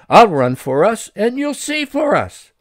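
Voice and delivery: calling back. Acted. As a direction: answer her from afar, glad. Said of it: "Pedro called back".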